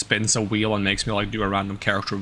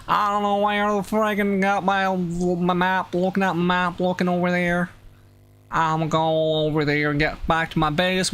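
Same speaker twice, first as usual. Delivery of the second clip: put-on high, twangy made-up North Pole accent; 7 semitones higher than usual. Streamer slipping into a made-up North Pole accent. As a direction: ramble in the silly made-up accent. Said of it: "my brain is thinking in that accent".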